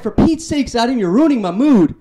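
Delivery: put-on fed-up girlfriend. Girlfriend scolding Adam at the mall, high exasperated voice. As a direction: squeal it like she's had enough of him. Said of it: "She's like".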